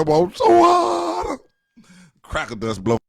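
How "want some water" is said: very high, loud, drawn-out mock wail; hammed-up scream. Neglected parent begs from the back room, mock screaming. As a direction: scream it like a helpless parent with a dry mouth. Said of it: "screaming cracker dust".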